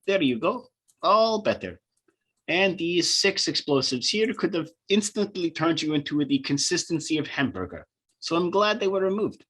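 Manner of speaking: doctor character, voice pitched well up; pleased doctor. Acted. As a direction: reassure the patient as a cheery doctor. Game master voicing the doctor.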